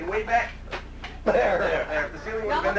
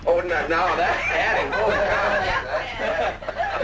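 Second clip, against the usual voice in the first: laughing; much slower than usual. Real, not acted. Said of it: "And that's my mom laughing".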